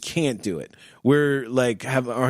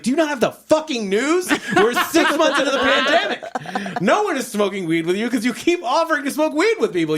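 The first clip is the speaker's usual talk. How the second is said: high, laughing, exasperated outburst; exasperated rant; 12 semitones higher than usual. Retold or imitated. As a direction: rant at him through laughter, much higher. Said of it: "I was like".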